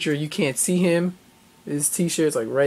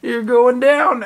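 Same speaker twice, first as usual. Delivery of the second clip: higher, louder, slow hammed-up taunt; over-the-top trash talk; mock wrestler threat; 7 semitones higher, 7 dB louder, much slower than usual. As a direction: drag out the threat like a promo. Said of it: "and he's like".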